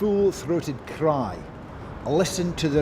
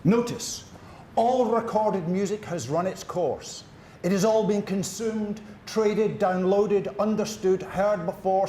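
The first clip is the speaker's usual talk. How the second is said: slow, higher, softer declamation; solemn proclamation; 6 semitones higher, 4 dB quieter, much slower than usual. Acted. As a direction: pronounce it like a manifesto.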